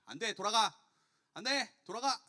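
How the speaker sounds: loud, gruffly dismissive judge; stern refusal. Acted. Judge turning the widow away, shouted refusal. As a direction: send them away with a forceful shout. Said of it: "계속적으로 그 요청을 거부했던 것 같아요".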